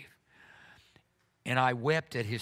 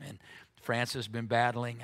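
ordinary talk

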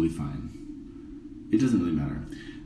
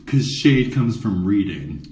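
slow punchline; 3 dB louder, slower than usual. Acted. Punchline delivery.

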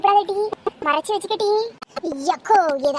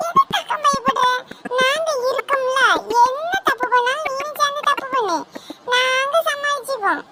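put-on child voice; 7 semitones higher than usual. Child reassures a scared friend.

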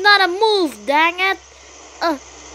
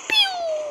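high squeaky pew; high mock laser pew; laser noise; 5 dB quieter than usual. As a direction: zap like a toy blaster. Imitating a laser shot.

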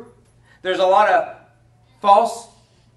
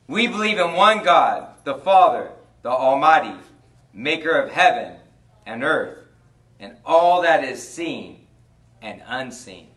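creed recitation; 3 semitones lower, 5 dB louder than usual. Acted.

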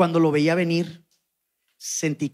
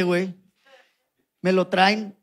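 ordinary talk

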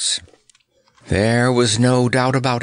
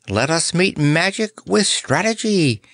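cawing; 8 semitones higher than usual. A narrator's reading. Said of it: "cawed Nick".

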